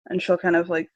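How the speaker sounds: ordinary talk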